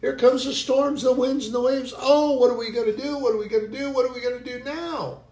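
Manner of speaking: high, frantic cries of alarm; panicked disciples. Acted. Disciples panicking at the storm, frantic high cries. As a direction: squeal like men sure they will drown. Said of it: "the disciples got all agitated, like".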